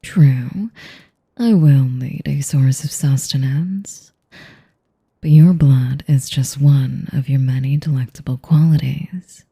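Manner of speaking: normal talking voice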